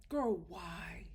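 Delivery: hushed, low, almost voiceless plea; whispered dismay. Real, not acted. Host pleading with Paige over his cruel line, whispered dismay. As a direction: whisper it in weary disbelief.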